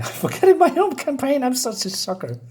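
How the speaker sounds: real embarrassed laugh through the words; laughing at himself. Real, not acted. Host laughs at forgetting his own campaign's name, high laughing.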